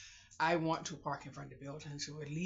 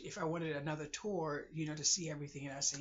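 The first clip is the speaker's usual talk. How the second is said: ordinary talk, nothing marked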